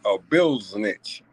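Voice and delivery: "bill snitch" stretched very slowly; slang pronunciation. Shown as a demonstration. A vlogger repeats the insult to viewers, "bill snitch" slowly. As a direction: drag out "bill snitch" in his own slang. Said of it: "That's my slang of saying it".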